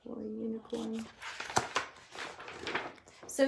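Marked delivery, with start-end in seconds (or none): 0.0-1.0 sympathetic aside. Real, not acted. Sad aside.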